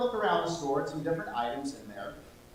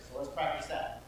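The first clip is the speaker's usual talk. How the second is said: ordinary delivery, nothing marked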